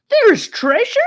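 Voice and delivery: very high, loud thrilled cry; excited outburst. A narrator's reading. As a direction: burst out at the word treasure. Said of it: "Charlie burst out with excitement".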